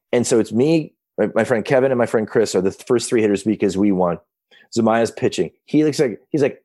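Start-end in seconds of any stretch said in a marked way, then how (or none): none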